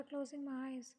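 ordinary delivery

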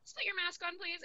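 a mildly irritated snap; imitating a fed-up cashier, lightly annoyed. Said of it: "I'll say it in a really annoyed voice".

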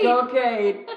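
loud, high cheering shout; real cheer. Real, not acted. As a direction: join in rooting for them at full voice. Joins the cheer for relative.

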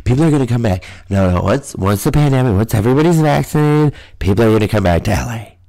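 mock hopeful voice, rising in pitch; imitating hopeful holdouts. People holding out hope for L A, mockingly voiced. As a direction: put on the pleading of naive believers. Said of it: "They're like".

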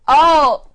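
loud, high spooky "oh"; ghostly moan. Acted. Marley's ghost arriving to haunt Scrooge, loud wail.

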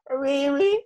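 high-pitched mock questioning voice; joking imitation. Retelling a funny moment from the clash, high joking imitation. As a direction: squeak it out as a playful imitation. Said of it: "another part gave me joke".

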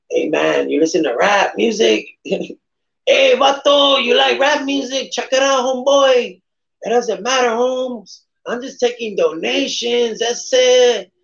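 high, hammed-up Chicano homeboy voice; West Side voice. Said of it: "my West Side voice".

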